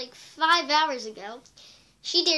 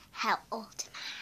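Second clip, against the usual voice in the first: breathy whispered question; whispering the question; 4 semitones lower, 9 dB quieter than usual. Real, not acted. Question whispered, later repeated.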